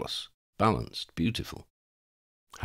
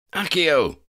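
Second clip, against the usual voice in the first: loud, higher battle cry; shouted spell; 10 semitones higher, 6 dB louder than usual. Acted. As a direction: shout the spell across the room. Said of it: "Harry shouted".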